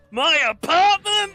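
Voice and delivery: hammed-up villain wailing over a loss; over-the-top villain rant peak; dramatic outburst. Acting out a villain's grievance.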